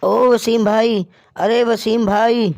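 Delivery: loud, slow call across the night. Acted. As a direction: call his friend's name from outside the house. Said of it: "उसे पुकारने लगा".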